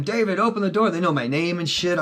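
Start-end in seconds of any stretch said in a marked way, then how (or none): 0.0-0.9 raised, shouted police demand at the door; a mild mock cop yell through the door. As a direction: play the cop banging and calling his name.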